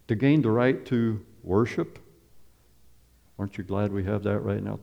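normal talking voice

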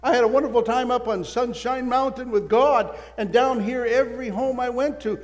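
joyful boast to his wife of big sales, excited and loud. Retold or imitated. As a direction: gush it out, thrilled and laughing. Bursting with his good news.

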